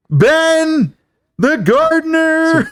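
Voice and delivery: high, drawn-out big reveal; dramatic reveal. Acted. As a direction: announce the culprit with huge, stretched-out suspense. Dramatic villain reveal in retelling.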